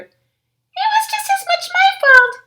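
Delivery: put-on very high, loud girl-cub shout. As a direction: cry out to share the blame. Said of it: "shouted Sister".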